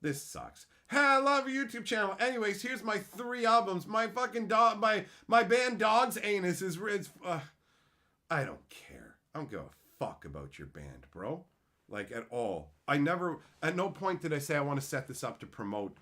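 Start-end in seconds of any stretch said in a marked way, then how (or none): 0.9-7.4 higher, louder eager pitch; mocking spam musician. 8.3-11.1 low unvoiced whisper. Real, not acted. Whispered aside.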